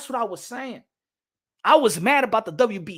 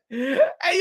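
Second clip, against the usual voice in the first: ordinary talk, nothing marked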